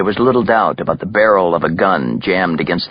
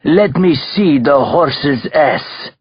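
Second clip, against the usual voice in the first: put-on high, slow, contemptuous Russian accent; ordered; 7 semitones higher, much slower than usual. A narrator's reading. Belova orders Terenzi to turn, commanding. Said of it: "ordered Belova".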